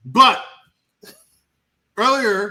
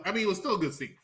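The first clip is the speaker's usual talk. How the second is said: usual speaking voice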